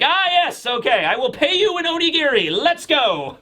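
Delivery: high, swooping scientist character voice; exclaiming, hammed-up high role voice; Vegapunk. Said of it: "Vegapunk's like".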